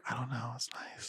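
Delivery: soft breathy whisper. Real, not acted. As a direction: whisper it right in his ear. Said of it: "Why are we whispering?".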